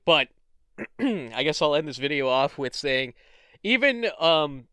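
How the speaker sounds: ordinary talk, nothing marked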